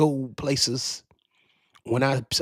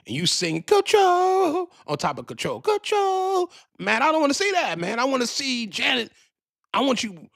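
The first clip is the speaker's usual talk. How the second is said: exasperated rant; 11 semitones higher than usual. Real, not acted. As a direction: voice jumps higher in exasperation, nearly wailing. Ranting about singers using backing tracks.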